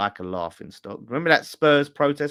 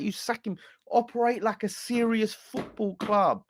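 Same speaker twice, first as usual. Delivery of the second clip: high-pitched, exasperated plea to the board; exasperated rant; 9 semitones higher than usual. Real, not acted. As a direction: pitch it way up, fed up and pleading. Frustrated fan rants at the board.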